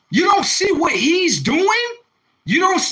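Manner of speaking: angry shout. Real, not acted. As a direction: yell it at the commenter in disbelief. Heated rant at a commenter.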